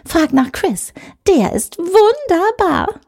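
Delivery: high, slow swooning show-off; gushing colleague. Acted. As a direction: sigh out the name like a crush. Susan's voice again.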